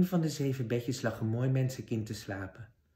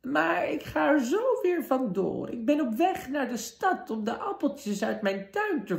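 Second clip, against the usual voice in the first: put-on trembling old woman; 3 dB louder than usual. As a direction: quaver like a frail old woman hiding a wicked queen.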